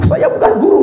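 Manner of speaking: high, laughing protest; laughing mock teacher.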